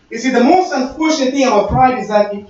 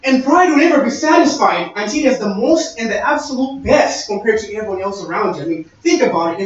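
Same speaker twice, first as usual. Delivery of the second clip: ordinary talk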